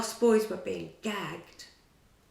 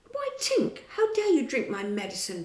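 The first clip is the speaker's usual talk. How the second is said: cross; 5 semitones higher, 4 dB louder than usual. A narrator's reading. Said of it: "Peter said crossly".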